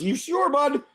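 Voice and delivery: high-pitched questioning doctor; doubtful doctor. Acted. Doctor questioning the buyer's sword choice, high doubtful voice. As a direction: query it up high, in character. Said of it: "He goes".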